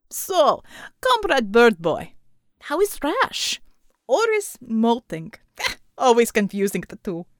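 put-on loud, slow Russian-accented teasing with a laugh; a jovial big Russian ribbing a rival; booming Russian accent, laughing; Russian bear. Pavel teases Corby.

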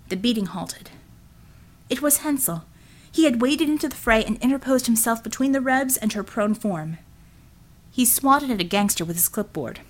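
plain reading voice

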